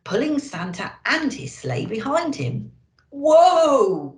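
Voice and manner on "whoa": loud startled whoa; cried out. A narrator's reading. Santa crying out as the sleigh lurches, loud. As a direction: rein in the sleigh with a sudden cry. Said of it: "cried Santa".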